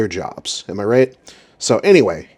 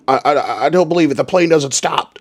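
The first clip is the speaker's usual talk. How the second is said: flustered pilot; 5 semitones higher, 4 dB louder than usual. Retold or imitated. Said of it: "the change in his demeanor".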